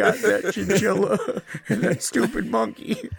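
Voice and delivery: talking through real laughter; laughing. Real, not acted. Joking as the mauled monkey owner, laughing.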